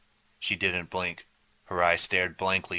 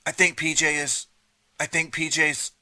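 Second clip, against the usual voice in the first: high, faltering, choking words; choked, frightened faltering; breaking fear; 9 semitones higher, 4 dB louder than usual. A narrator's reading. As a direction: stop short, unable to go on. Rini can't say the word.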